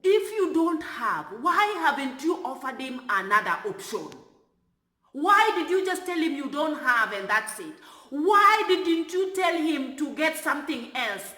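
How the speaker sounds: hammed-up yelling at the attendant; imitating a shouting passenger; retold yelling. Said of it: "He was yelling and he was telling me".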